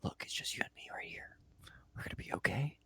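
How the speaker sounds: soft reassuring whisper; whispered calming-down, close and private; hushed soothing. Acted. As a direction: shush them gently, then whisper. Hushing and soothing an upset wife.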